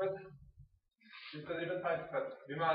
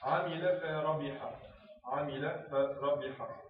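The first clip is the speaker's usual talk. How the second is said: very slow, every vowel of عمل فربح spelled out; slow dictation; much slower than usual. Shown as a demonstration. Teacher dictating an example sentence very slowly. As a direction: sound out عمل فربح syllable by syllable. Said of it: "نضرب أمثلة".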